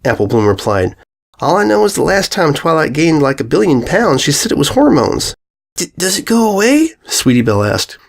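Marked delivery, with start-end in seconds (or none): 1.4-5.3 put-on lightly raised young filly voice; a filly answering her friend; Apple Bloom voice. A narrator's reading. Filly to her friends, lightly voiced. 5.8-6.9 lightly voiced young filly, unsure; a small filly shrugging off worry; Sweetie Belle voice. A narrator's reading. Young filly to her friends, lightly voiced and hesitant.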